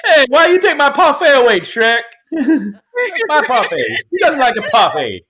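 high, loud cartoon donkey voice, laughing through it; Donkey impression. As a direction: squeal like the animated donkey, cracking up. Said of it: "donkey just slipped out of me".

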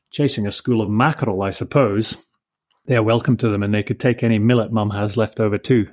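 ordinary delivery

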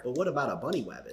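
"bunny wabbit" with w for r, quick; Elmer Fudd voice. Acted. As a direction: lisp "wabbit" like a cartoon hunter. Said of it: "Elmer Fudd over here".

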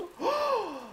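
sharp high gasp of fake shock; mock horrified gasp. Reacting to the cursed frogurt with a mock gasp. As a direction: gasp like hearing terrible news.

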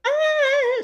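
very high mock [name] whistle note. Karaoke singer hitting a [name] high, mock. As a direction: hit the high note like a diva. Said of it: "[name] singing [name]".

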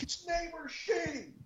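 high, drawn-out call, held back in volume; mock-yell it softly, far up in pitch; retold yell upstairs. Neighbor calling up to the third floor, hushed high mock yell. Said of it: "to yell up to the third floor".

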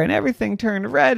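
plain reading voice

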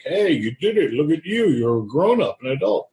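high, proud praise; proud cheering voice. Retold or imitated. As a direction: cheer him on like a proud relative. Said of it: "be like".

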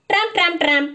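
high, loud rhythmic tramping; tramping shoes. A narrator's reading. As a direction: beat it out like marching feet. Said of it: "go their shoes".

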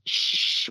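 long voiceless shush; hushing. Retold or imitated. Older brother silences the frightened child, hushing.

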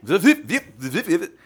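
high, zippy tape-rewind chirps; rewind noise. Pretend. Co-host joining the trip back to episode two, high rewind noise. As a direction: squeal like a tape spooling back. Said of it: "that weird rewind noise".

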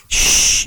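sharp unvoiced shush; librarian shush. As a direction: hush it like a librarian. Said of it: "You hear the librarian go".

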